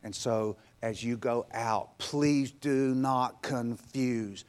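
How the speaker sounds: low, soft, slow confession of faith; quiet preaching. Acted. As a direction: draw them in softly. Quiet closing declaration.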